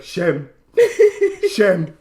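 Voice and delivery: "Shem" with a short vowel, accidentally Jamaican; Jamaican-sounding accent. Acted. Crowd condemning him, Jamaican-sounding chant of "Shem". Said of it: "That was really Jamaican, th-, that accent".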